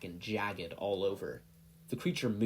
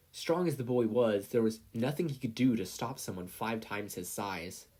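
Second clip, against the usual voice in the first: usual speaking voice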